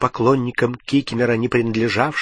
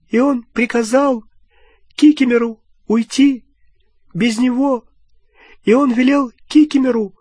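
high, louder, tear-choked elf voice; sobbing elf, rasping; 12 semitones higher, 5 dB louder than usual. A narrator's reading. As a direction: grief grinding into a rasp. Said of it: "Рыдания Кикимера обратились в подобие скрежета".

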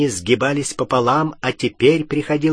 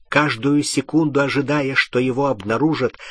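ordinary delivery

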